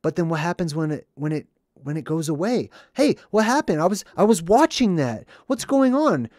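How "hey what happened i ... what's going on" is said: higher and louder, protesting the interruption; mock indignant viewer. Commentator mocking a viewer whose show stopped, louder. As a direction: whine like your show got cut off. Consumer upset when ad cut.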